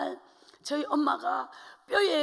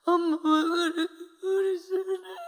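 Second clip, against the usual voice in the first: tearful exchange; 4 semitones higher, 3 dB louder than usual. Retold or imitated. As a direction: let the tears show. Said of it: "엄마 혼자 막 그렇게 슬픈 눈물을 흘려요". (슬프게 울먹이며)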